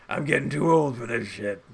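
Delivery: hint of Murtaugh, worn out and grumbling; weary Murtaugh line. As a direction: play the grizzled partner who has had enough. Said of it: "Capper's like that dude from Lethal Weapon, Murtaugh".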